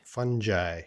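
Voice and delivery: "Fungi" as FUN-jai, soft g; pronunciation with soft g. Shown as a demonstration. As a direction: say "Fungi" with a j sound, ending like "eye". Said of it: "pronunciations of that word".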